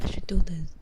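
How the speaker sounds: normal talking voice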